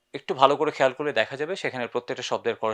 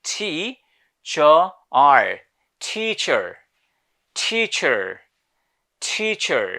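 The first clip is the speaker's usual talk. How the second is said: teacher in an American accent with the r at the end; 3 semitones higher, 6 dB louder, much slower than usual. Shown as a demonstration. Teacher showing the class the American teacher, with the r. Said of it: "in American English".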